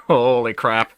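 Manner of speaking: stunned exclamation, punched on "holy"; amazed. Real, not acted. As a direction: blurt it out in real disbelief. Repeats it in amazement.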